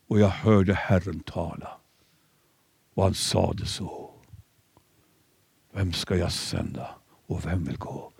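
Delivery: low, soft, fading into a whisper; hushed awe. Acted. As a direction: sink into an awed whisper. Isaiah's call quoted.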